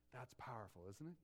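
ordinary delivery

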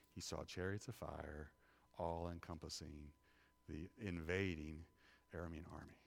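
low, soft, reverent sermon voice; solemn preaching. Real, not acted. Preacher urging listeners to pray in darkness, hushed and solemn. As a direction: bring it down to a hushed, earnest appeal.

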